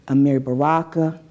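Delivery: usual speaking voice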